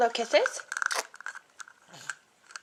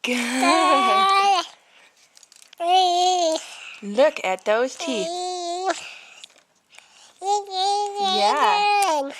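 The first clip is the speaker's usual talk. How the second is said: teary praise; 8 semitones higher, 5 dB louder, much slower than usual. Real, not acted. Parent praising baby, voice breaking.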